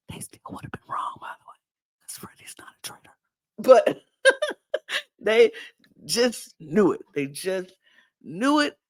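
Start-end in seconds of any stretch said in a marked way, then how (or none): 0.1-3.1 very soft, quick whisper; whispered aside. Real, not acted. Host to viewers about Freddie, whispered aside. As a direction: slip it in under your breath, whispering.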